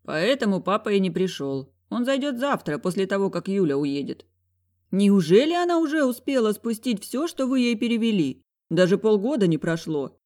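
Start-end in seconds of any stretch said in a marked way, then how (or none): 4.9-10.2 indignant. A narrator's reading. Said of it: "возмущалась чем-то мама".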